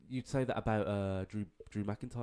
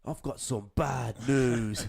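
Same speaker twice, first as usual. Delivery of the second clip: slow, louder mock wrestler line; Wade Barrett impression; 7 dB louder, much slower than usual. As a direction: drawl it out like a heel wrestler. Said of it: "talk like Wade Barrett".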